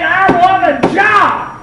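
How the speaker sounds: retold bold demand; emphatic demand. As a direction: insist on it, leaning on each word. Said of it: "I said".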